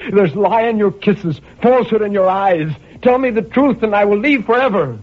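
high, strained, pleading for the truth; desperate plea. A narrator's reading. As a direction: beg her for the truth, voice rising high.

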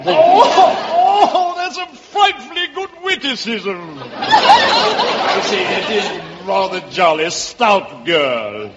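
high, tittering English toff; hammed-up English accent, hugely amused. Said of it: "that's a wonderful English accent".

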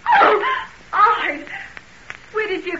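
high, shocked cry; startled fright. Acted. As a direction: jump out of your skin on seeing him. Caught by Hyde's sudden appearance.

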